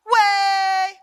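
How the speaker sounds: Trinidadian call of "way", high and loud. Shown as a demonstration. Trinidadian dancer teaching a crowd, loud high call. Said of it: "everything is a sing-song 'cause that's how our accent goes".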